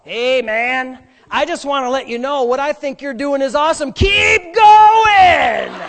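loud hippie voice building to a shout; Sean. Retold or imitated. Hippie brother egging on the runaways, rising to a yell. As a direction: cheer them on like a new-age hippie. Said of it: "this is how he talks".

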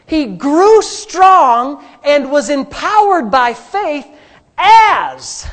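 high, loud, slow shouted preaching; preaching at full force. Acted. Pastor urging the congregation to faith, shouting. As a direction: drive every phrase home to the crowd.

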